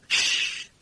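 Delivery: a sharp unvoiced hiss; breathy warning shh. A narrator's reading. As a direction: hush him with a hiss. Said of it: "hissed Tilly".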